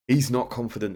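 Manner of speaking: He's not confident, almost shouted. Real, not acted. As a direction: push the repeat out harder. Repeats it louder.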